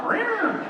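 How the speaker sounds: high cat yowl, falling at the end; imitated high-pitched meow; cat sound. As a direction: sneak in one last screech like a cat. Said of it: "give her one more".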